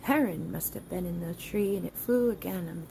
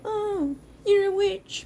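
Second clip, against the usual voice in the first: mocking accusers; 11 semitones higher, 4 dB louder, slower than usual.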